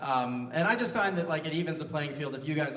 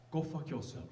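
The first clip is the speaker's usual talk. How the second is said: softer put-on Japanese accent; 8 dB quieter than usual. Shown as a demonstration. Comic warning it gets dicey, mock Japanese accent. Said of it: "it's the southeastern region of Tokyo".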